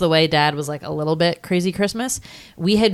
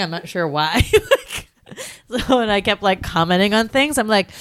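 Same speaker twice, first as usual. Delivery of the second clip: normal talking voice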